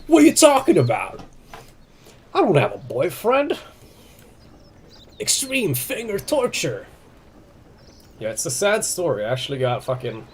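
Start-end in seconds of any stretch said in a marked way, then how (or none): 0.1-3.6 high, loud incredulous protest; indignant denial. Real, not acted. Streamer to chat about the boyfriend comment, loud indignant disbelief. 5.2-6.9 high, slow hammed-up announcement; mock dramatic. Streamer to chat naming her injury, slow hammed-up drama.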